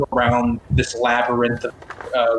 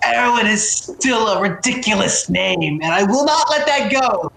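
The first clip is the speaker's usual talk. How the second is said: Galileo; 7 semitones higher than usual. Acted. Galileo complaining from inside a party member's bag, high and indignant. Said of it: "Galileo on your bag is like".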